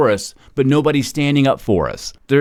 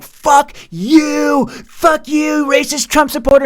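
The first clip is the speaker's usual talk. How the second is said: much higher, hammed-up angry voice; mock screaming critic; slower than usual. Mocking people who reject his favor, shrill high caricature. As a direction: shriek it like a caricature of an outraged critic. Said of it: "and they're like".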